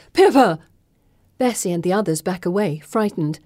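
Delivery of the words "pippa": loud alarmed call of a name. Acted. Felicity calling out to Pippa in alarm. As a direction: cry out to her fallen friend. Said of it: "Felicity calls".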